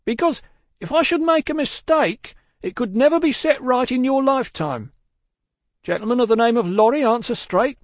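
high, loud, commanding call; called-out warning. A narrator's reading. Guard warning rider, then summoning passenger, loud call. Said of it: "the guard called to the voice".

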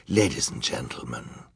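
low villainous preamble; oily villain orator. A narrator's reading. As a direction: address the court with hushed self-importance. Olaf begins his speech.

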